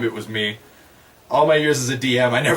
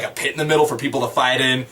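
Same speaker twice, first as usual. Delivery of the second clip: usual speaking voice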